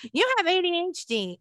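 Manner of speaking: mock her like a playground bully; mocking taunt. Relatives teasing her as a child, mocking taunt. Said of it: "making fun of me".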